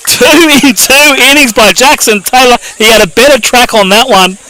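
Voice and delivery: high, loud, punchy commentary; excited play-by-play. Real, not acted. Commentator recapping Jackson Taylor's two double plays, excited play-by-play. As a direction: keep the big-play energy up, pitched high.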